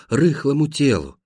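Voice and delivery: ordinary delivery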